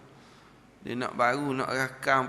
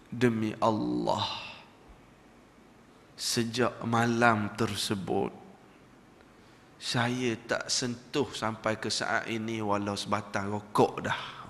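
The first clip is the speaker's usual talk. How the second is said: low, slow, sincere vow; solemn oath; 5 semitones lower, 4 dB quieter, slower than usual. Retold or imitated. Former smoker swearing to the Syekh, low and slow. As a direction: swear it quietly and earnestly.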